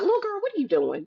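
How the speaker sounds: high, quick motherly challenge; retold mom questioning, high and fast; imitating mother. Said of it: "that was my mother's saying".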